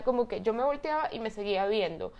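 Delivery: usual speaking voice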